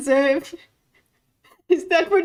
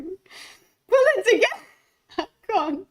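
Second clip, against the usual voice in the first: telling herself off through giggles; delirious laughing; 5 semitones higher than usual. Real, not acted. Streamer scolding herself mid-giggle, still laughing. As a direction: giggle while trying to stop. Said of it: "I am delirious".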